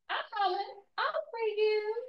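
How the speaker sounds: high, soft put-on voice; mock enslaver promise. Enslaver promising to free her, in a high mocking voice. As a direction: sing out the promise high and softly.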